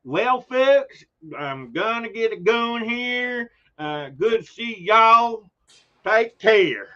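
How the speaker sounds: slow, high Southern drawl, a New Yorker trying hard; Southern accent. Retold or imitated. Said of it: "I can't do Southern accents".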